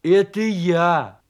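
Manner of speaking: loud, drawn-out call; hearty, slow holler; shouting. Acted. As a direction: shout it cheerily through a door. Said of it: "крикнул из-за двери медвежонок".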